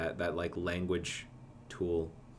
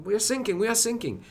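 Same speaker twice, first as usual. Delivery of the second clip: high desperate radio plea; distressed sailor; 5 dB louder, faster than usual. Retold or imitated. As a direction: plead over the radio, voice pitched high. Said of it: "he's hearing this, this transmission".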